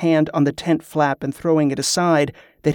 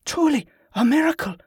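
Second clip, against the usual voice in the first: Yvette's awed voice; 11 semitones higher, faster than usual. A narrator's reading. Yvette in awe.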